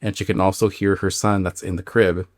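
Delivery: ordinary talk, nothing marked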